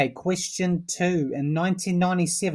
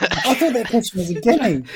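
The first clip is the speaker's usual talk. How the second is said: amused disbelief through laughter; chuckling while talking; laughing; 5 semitones higher, 4 dB louder than usual. Real, not acted. Laughing at the wrong answer.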